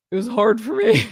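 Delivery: a hint of self-pitying impression; Megan. Host does the other woman's hardship refrain, mocking impression. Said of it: "do the other woman's voice".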